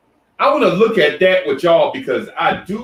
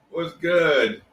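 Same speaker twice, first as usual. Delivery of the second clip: ordinary delivery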